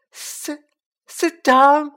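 very high elf wail, bursting loud. A narrator's reading. Elf bursting into noisy tears, wailing. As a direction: start choked, then wail it out. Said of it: "he wailed".

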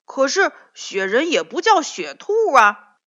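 loud, high angry comeback. A narrator's reading. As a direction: outshout the rabbit. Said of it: "嗓门更大了".

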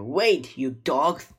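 loud shout, highest on the first word; angry call. A narrator's reading. Pirate captain running after his men, shouting. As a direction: yell after your deserting crew.

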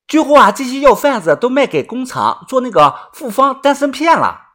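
high-pitched rustic explainer; knowing country follower. Acted. Yuanbao explaining the herb's value, high and eager.

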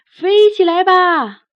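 long drawn-out shout, arms wide; big shout. Acted. Berio, arms spread, shouting at the carpet to fly, drawn-out yell. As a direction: call it out slow and loud to the sky. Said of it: "贝里奥张开手臂大喊".

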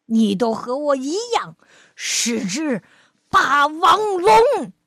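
big, loud, booming dinosaur voice, slowing on the last word; a storybook T-rex bellowing; menacing T-rex. Acted. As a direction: loom over the little one and boast. T-rex claims Liangtai as kin.

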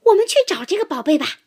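high, fast, loud excited cry; excited fox. A narrator's reading. Fox urges friends to find treasure, excited. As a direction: burst out eager to hunt treasure. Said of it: "狐狸兴奋地叫起来".

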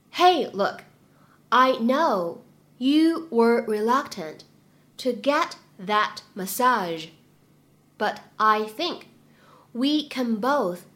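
very slow, phrase by phrase with pauses; slow chunked reading. Shown as a demonstration. Teacher slowly modelling the line for students, chunk by chunk. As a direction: read it slowly in small chunks for learners. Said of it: "我们需要注意哪些发音技巧呢".

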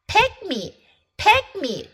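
high, eager plea of a cat; a little cat begging to be picked; eager cry. Acted. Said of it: "cried Cat".

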